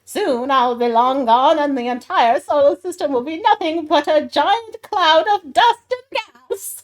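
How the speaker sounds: loud, high gloating villain. Acted. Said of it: "That's a good one".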